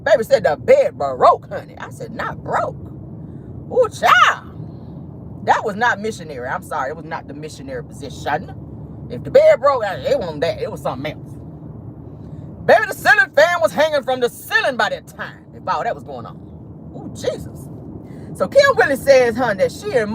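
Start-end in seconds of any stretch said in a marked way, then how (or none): 2.1-5.5 clutching pearls, high and hushed; scandalized high gasp. Real, not acted. Scandalized by gossip detail. 16.9-17.7 clutching pearls, high and hushed; scandalized high gasp. Real, not acted. Scandalized by gossip detail.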